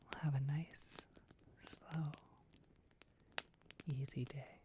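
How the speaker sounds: soft unvoiced whisper, gentle and unhurried; ASMR roleplay whisper. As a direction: whisper softly to someone just waking up. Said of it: "waking up with Elia roleplay".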